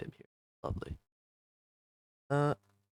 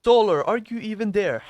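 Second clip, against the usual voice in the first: calling out; 9 semitones higher, 8 dB louder than usual. Real, not acted. Calling out to a silent student.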